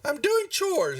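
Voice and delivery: put-on high, whiny kid voice; put-on child answering Mom back; little boy. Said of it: "And he goes".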